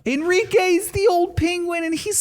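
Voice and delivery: high, cutesy penguin voice. Pretend. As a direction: coo it sweetly. Cute voice for penguin.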